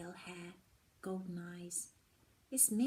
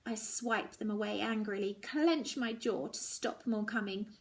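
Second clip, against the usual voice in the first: usual speaking voice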